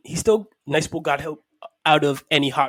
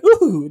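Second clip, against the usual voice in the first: high, loud "ooh"; mimicked ticklish squeal; 11 semitones higher, 9 dB louder than usual. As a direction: imitate a startled, ticklish yelp. Acts out character's ticklish reaction.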